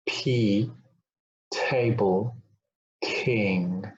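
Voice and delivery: P, TABLE, KING each opened with a puff of air; aspirated plosives. Shown as a demonstration. Teacher demonstrating with a sheet of paper, P, TABLE, KING aspirated. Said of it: "move a sheet of paper".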